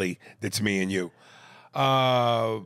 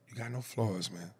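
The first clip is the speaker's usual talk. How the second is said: much softer repeat of "You got no flaws"; quiet; 11 dB quieter than usual. Shown as a demonstration. Host repeats praise to emailer, hushed.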